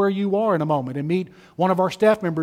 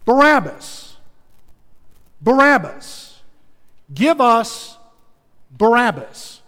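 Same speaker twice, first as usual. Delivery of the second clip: higher, louder chant of the crowd; preacher playing the mob demanding Barabbas, shouting; 4 semitones higher, 4 dB louder, slower than usual. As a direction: roar it like an angry mob. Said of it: "And they shout".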